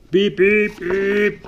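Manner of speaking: loud high beeping horn; horn cry. Acted. Little snowplow crying out a warning, loud high beeps. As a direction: blast the horn in alarm. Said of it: "the little snowplow cried".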